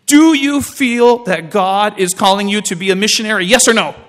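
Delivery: loud, pointed challenge from the uncle; stern demand. Retold or imitated. Uncle confronting his nephew about the mission call, loud and stern. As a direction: press him hard for a straight answer.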